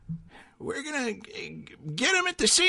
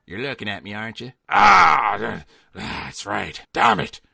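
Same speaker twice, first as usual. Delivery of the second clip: low dread voice; 5 dB louder than usual. Acted. Boss turns on the character.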